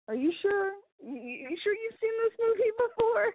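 high, incredulous squeak of doubt; are you sure you've seen this movie; mock disbelief. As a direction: act stunned that they're wrong. Teasing friend, mock disbelief.

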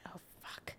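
breathless whispered Oh, fuck; whispered realization. Retold or imitated. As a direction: drop to a stunned whisper. Retells Andy's realization.

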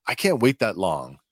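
ordinary talk

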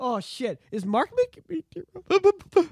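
hammed-up fighting back tears; mock choked up. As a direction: slowly play at welling up. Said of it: "choked me up a bit".

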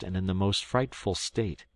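usual speaking voice

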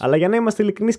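ordinary talk

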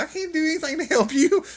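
high, loud squealed protest; panicked helplessness. Retold or imitated. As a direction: act out a comic panicked refusal. Retold panicked reply to her plea.

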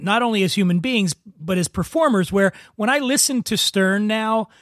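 ordinary talk

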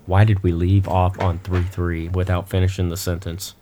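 ordinary talk, nothing marked

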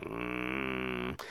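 low disapproving hum; glaring grunt. Retold or imitated. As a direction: grunt a dirty look. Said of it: "I give him that glare".

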